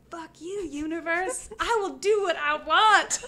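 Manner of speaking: playfully rebellious, rising to a loud finish; defiant retort. Retold or imitated. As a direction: tell the universe off, hammed-up. Defying the universe.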